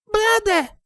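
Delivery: very high little filly call; high-pitched child's call. A narrator's reading. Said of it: "Little Wing called out".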